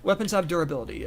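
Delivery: ordinary talk, nothing marked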